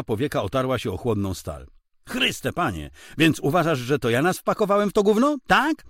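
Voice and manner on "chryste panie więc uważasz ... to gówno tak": rising higher and louder, fast scornful jab; mocking irritation. Husband mocking his wife, irritation building loud. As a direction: sneer it, climbing to an outraged tak. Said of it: "z nieukrywaną kpiną w głosie i narastającą irytacją".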